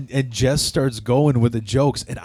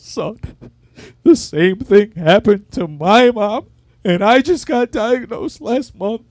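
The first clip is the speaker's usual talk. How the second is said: mock old man's voice, higher and shaky with grief, slower; old man in wheelchair; 11 semitones higher, 6 dB louder than usual. Old man grabs his shoulder, tearfully consoling in a higher voice. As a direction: play the weepy old man consoling him. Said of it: "he goes".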